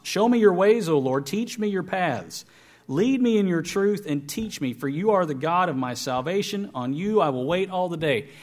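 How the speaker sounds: scripture reading. Acted. Reads scripture aloud.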